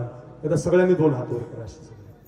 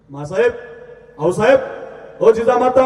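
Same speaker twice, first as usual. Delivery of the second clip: rising calls, higher and louder each time; calling out for mother; 8 semitones higher, 6 dB louder than usual. Acted. Young Shivaji runs to his mother, calling out loudly. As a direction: call out eagerly to mother across the house.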